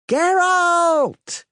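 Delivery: very high, loud call across the crowd. A narrator's reading. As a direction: hail a friend from afar.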